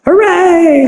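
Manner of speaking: high, sarcastic little cheer; mock cheer. Comic telling the party story, mock cheering. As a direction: sell the fake celebration.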